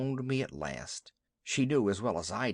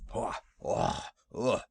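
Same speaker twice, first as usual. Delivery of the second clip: breathy snorting haw haw ugh; startled cattle grunts, no voice; bullocks' grunt; 5 semitones lower, slower than usual. A narrator's reading. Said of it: "said the bullocks".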